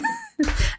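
high squealing giggle; ticklish squeal. Real, not acted. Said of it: "I'm ticklish".

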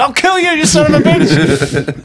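mock Tom Cruise. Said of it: "and he's like".

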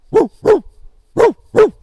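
short, loud, high barks; dog bark. Acted. The dog answers the greeting, barking loudly. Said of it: "he just barks".

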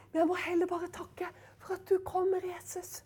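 soft unvoiced whisper to Jesus; whispered prayer. Real, not acted. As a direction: pray it under the breath, intimate. Preacher drops into whispered prayer. (hviskende bønn)

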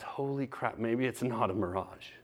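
soft, low retold thought; hushed realization. Man realizing his faith may be real, hushed. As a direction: let it dawn quietly, voice dropped.

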